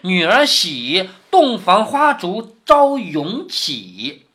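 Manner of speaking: very slow verse with stretched line endings; drawn-out recitation. Acted. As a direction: recite it grandly, lingering on each phrase. 薛蟠念最美的酒令. (拖长声调慢慢吟诵)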